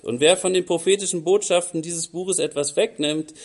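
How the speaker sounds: loud, declaiming Bible reading; scripture reading. Acted. Preacher reading Revelation aloud to the church, loud and declaiming. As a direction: proclaim the text to the congregation. Reads closing scripture warning.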